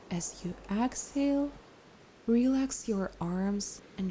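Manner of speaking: unhurried, gentle breathing cues with long pauses; guided meditation. Acted. Leads breathing and relaxation.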